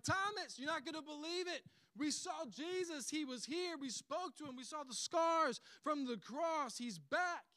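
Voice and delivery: high, breathless-with-news excitement; excited news-breaking. Acted. Disciples telling absent Thomas the news, acted excitement.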